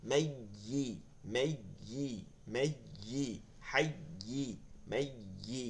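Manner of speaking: মেইয়ি with a hardened doubled ইয়া and a soft rising end; strong ইয়া. Shown as a demonstration. Teacher drilling মেইয়ি with a firm ইয়া. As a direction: stress the ইয়া firmly in মেইয়ি. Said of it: "ইয়ার উচ্চারণটা শক্ত করতেছে".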